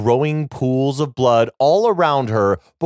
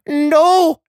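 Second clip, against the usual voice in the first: high, desperate cry; desperate shout; 6 dB louder than usual. A narrator's reading. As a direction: cry out to stop him. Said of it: "Aisling says and rushes to the door".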